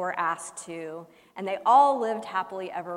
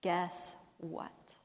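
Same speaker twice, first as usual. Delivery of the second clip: soft murmur; 3 semitones lower, 7 dB quieter than usual. Retold or imitated. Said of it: "I'm gonna whisper to them".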